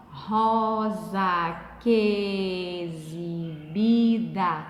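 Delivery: "rosa que exibida" very slow and low, "que" and "e" run into one syllable; long, even syllables in "rosa que exibida"; syllables with joined vowels. Shown as a demonstration. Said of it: "ficou assim".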